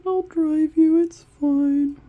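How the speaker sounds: high, loud breezy offer; casual friend offer. Retold or imitated. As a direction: toss it off like it's nothing. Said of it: "And Matt was just like".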